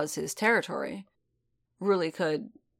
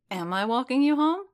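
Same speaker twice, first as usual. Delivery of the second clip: startled, raised question; surprised; 7 semitones higher, 5 dB louder than usual. Acted. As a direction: ask it taken aback.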